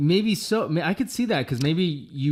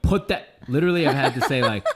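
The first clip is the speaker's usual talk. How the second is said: usual speaking voice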